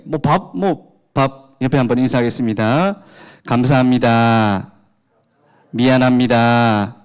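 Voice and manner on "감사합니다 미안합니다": loud, slow, drawn-out words; leading a response. Shown as a demonstration. Preacher has congregation greet their neighbours, calling it out slowly. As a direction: call it out slowly for a crowd to repeat.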